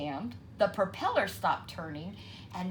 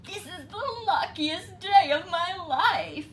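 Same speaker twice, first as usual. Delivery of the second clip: gleeful; 10 semitones higher, 7 dB louder than usual. A narrator's reading. Fox gloating.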